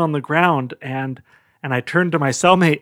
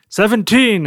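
high, slightly raised mock shout; 7 semitones higher, 4 dB louder than usual. Prisoner calling a joke number, shouting out. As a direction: call it out like a prisoner down the hall. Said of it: "I heard somebody shout out".

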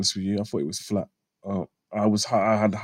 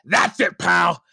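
loud, high, growing enthusiasm; hammed-up promoter urging on his wrestler; approving boss impression; 5 semitones higher, 4 dB louder, slower than usual. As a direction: punch out each phrase with delighted approval. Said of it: "he's done it parody and like".

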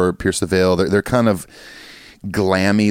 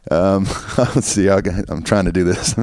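ordinary talk, nothing marked

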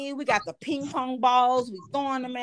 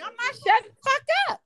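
much higher, defiant "not shutting up"; defiant high retort; 12 semitones higher than usual. Retold or imitated. Comedian refusing to back down to the lady boy, high and defiant.

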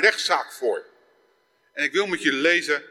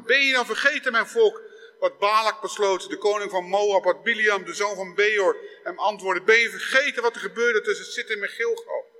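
pleading God; 3 semitones higher than usual. Acted. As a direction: cry out to a people who forgot you. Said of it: "En dan zegt hij".

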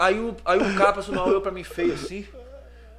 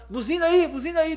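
higher shouted call; calling to drivers; 10 semitones higher, 4 dB louder than usual. Retold or imitated. Friend calling to drivers to honk, high shout. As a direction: yell at the cars to honk.

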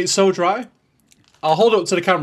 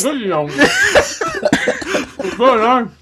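talking through a mouthful; words round a bite of food; mouth full; 7 semitones higher, much slower than usual. Real, not acted. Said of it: "Adam took the bite and I'm choking".

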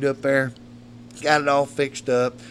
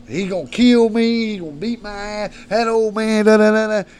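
higher, singsong imitation of a rant; mock rant; 6 semitones higher, 4 dB louder than usual. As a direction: mimic the son's blustering threats. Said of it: "he goes on a rant".

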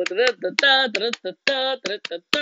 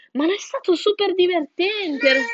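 mouse voice; 9 semitones higher, 3 dB louder than usual. A narrator's reading. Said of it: "il topo disse".

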